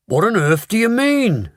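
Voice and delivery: high sharp demand; sharply. A narrator's reading. Father sharply questions Mister Wonka, alarmed. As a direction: snap the question out. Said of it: "asked Mister T V sharply".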